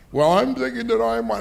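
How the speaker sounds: put-on high voice for a noncommittal caller; imitated wavering investor. Hesitant investor acted on phone.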